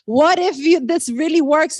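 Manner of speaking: fired-up urgent push; building, urgent. Acted. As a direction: raise it to rally the crowd. The question is repeated, building.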